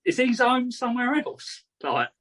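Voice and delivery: mock alarm with the pitch way up; freaked-out parent. Parents wondering about their little boy's claim, freaked out. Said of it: "we'd be, like, freaking out".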